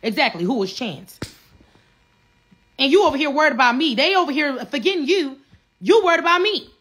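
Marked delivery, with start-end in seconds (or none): 2.8-6.7 louder, fast, heated; heated callout to a rival; angry outburst. Real, not acted. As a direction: throw it back at him. Angry rant climax.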